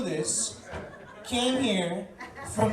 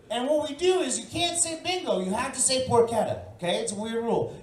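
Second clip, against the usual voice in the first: ordinary talk, nothing marked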